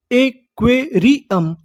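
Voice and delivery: Aquarium slowly, each syllable on its own; slow teaching breakdown of aquarium. Shown as a demonstration. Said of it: "तो meaning क्या बनी".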